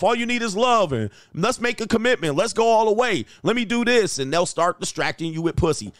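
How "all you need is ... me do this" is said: high, sweet-talking woman's voice; he mimics a woman luring a focused man; mock tempting woman.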